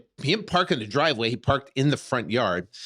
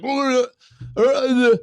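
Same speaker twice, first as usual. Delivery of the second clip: frightened stammer; 13 semitones higher, 7 dB louder, slower than usual. Retold or imitated. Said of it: "I couldn't get the words out".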